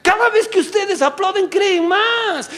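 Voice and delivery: high, slow pleading devil; high whiny devil, pleading. Pretend. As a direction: beg like a panicked demon.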